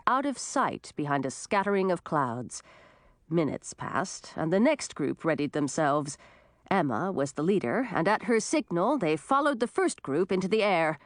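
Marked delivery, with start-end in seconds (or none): none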